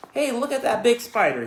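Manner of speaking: put-on high, loud boyish call; excited shout. Boy calling out to his sister about the spider, loud and high.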